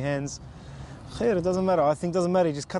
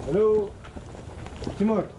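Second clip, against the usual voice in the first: raised, higher-pitched call; 6 semitones higher than usual. Real, not acted. Visitor calls for Timur at the door, calling out.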